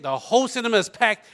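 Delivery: ordinary talk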